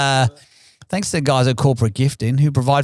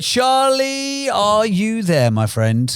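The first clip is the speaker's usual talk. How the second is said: calling out; 6 semitones higher, much slower than usual. Acted. Calling guest onto show.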